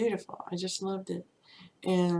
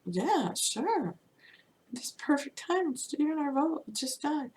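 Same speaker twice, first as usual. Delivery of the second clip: high, eager retold yes; imitating her own thrilled acceptance; 9 semitones higher than usual. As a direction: pitch it up like you can't believe your luck. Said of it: "I'm like".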